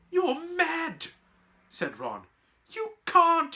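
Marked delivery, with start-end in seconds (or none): 0.1-1.1 very high, incredulous yelp; appalled. Acted. Boy reacting to friend's reckless plan, appalled. 2.7-3.5 high, loud horrified objection; alarmed protest. Acted. Girl begging friend not to go, alarmed.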